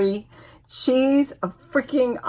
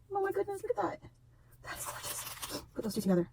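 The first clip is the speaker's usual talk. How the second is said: awed whisper; 10 semitones higher, 13 dB quieter, much faster than usual. Real, not acted. Awed over gift.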